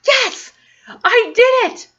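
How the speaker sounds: high, loud, giddy cheering to herself; excited self-cheer. Retold or imitated. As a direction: celebrate in the mirror, almost in tears. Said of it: "I'm like".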